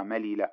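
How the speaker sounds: "مليل" as malila, each ل sounded apart; unmerged lam. Shown as a demonstration. Teacher gives the underlying verb, unmerged lam. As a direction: say "مليل" in three open syllables. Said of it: "أصلها".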